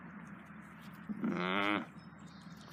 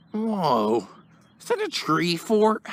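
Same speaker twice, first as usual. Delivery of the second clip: surprised; 8 semitones higher, slower than usual. Acted. Spotting the fort.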